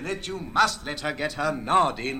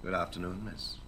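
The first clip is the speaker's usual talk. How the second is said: softer, lower, less heavy greeting; lighter demo greeting; 7 semitones lower, 6 dB quieter than usual. Shown as a demonstration. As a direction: show how it's done, lightly. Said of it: "I'll show you what I mean".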